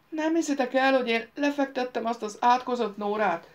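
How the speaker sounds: high-pitched, insistent boast; boastful protest. Acted. Réni protests to disbelieving friends.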